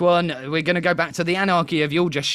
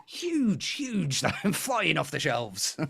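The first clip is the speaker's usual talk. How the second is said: mock boast; 5 dB quieter, much slower than usual. Sarcastic product boast.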